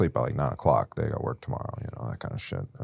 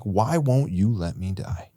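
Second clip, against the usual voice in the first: anguished plea; 4 semitones higher, slower than usual. Retold or imitated. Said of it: "I start yelling at the sky".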